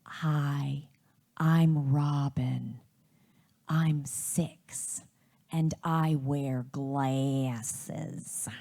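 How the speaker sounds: put-on low, slow child with a Wisconsin accent; weird Wisconsin accent. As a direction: drag out the vowels in odd Wisconsinese. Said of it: "in this weird Wisconsinian accent".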